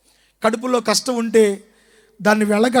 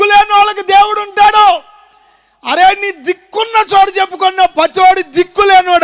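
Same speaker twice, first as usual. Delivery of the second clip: loud proclamation; 6 semitones higher, 7 dB louder than usual. Shown as a demonstration. Preacher leading the congregation, proclaiming it loudly. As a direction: shout the proverb out, high and loud. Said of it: "అదే గట్టిగా చెప్పండి".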